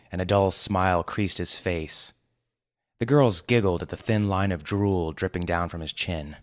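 ordinary delivery, nothing marked

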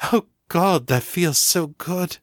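losing the thread to pleasure. A narrator's reading. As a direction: Ava overcome mid-warning. Ava overcome by pleasure.

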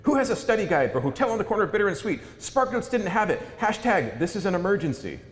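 put-on high, loud and fast, a frantic teen yelling; all caps shouting. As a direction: blurt it out fast and high like a panicked student. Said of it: "this young man is just shouting out to the void".

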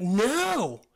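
mildly exasperated arguing back; acted refusal. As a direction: object as if it makes no sense. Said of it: "And he's like".

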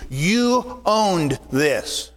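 "You" punched, words drawn out slowly; slow right down and land on "You"; slow emphatic. Shown as a demonstration. Pastor to his flock, slow emphatic "You owned this". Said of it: "a word that means from or concerning you".

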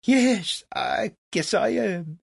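high, dreamy impresario voice; faraway self-regard. A narrator's reading. Legras accepts Franklin's praise, high and faraway. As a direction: agree as if lost in self-admiration. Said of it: "said with a faraway look".

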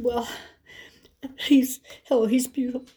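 high, halting imitation of a weepy caller; acted-out sobby, high phone voice; tearful friend. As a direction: gush through mock tears, haltingly. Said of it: "she is weeping. She is crying".

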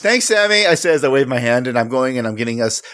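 usual speaking voice

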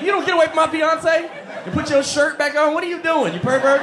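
high, loud protective outburst; outraged yell. Retold or imitated. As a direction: bark him off your fiance. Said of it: "I'm like".

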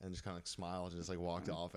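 ordinary delivery

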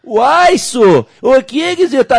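high, slow mock Mineiro drawl; Minas Gerais accent. Host imagining a Minas station's reporter, exaggerated Mineiro accent. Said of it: "de Minas, aí você vai pôr um repórter".

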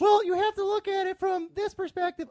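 high, mocking imitation of a friend; mocking friend's voice. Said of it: "I love the way that you have my voice going".

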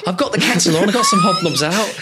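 desperate to make the visitor stay; mock-needy shouted welcome; clingy host. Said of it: "You go".

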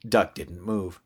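usual speaking voice

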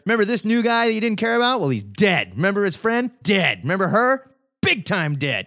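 high, fast, hammed-up announcer listing the dead; mock comic narrator. Mocking the comics to his co-host, in a high hammed-up voice. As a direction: gleefully read out a roll of deaths. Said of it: "page after page of".

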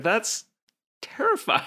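squeaky high horrified voice; mock horror. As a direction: squeal it in fake fright. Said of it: "And I'm like".

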